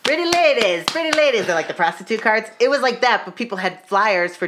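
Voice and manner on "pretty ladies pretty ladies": high-pitched mock hawker call; street card hawker. Street hawker touting cards to passersby, high mock call. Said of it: "standing on the street and they're going".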